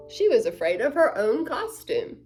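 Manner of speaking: usual speaking voice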